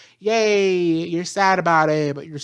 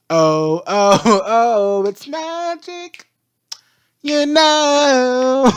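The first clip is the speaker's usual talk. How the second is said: laughing, loud, much higher mock wonder; sarcastic mock awe; 8 semitones higher, 8 dB louder, much slower than usual. Mocking Vine's ignorance with laughing loud sarcastic awe. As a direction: gush 'oh it's magic' with sarcastic laughter. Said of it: "And I'm like".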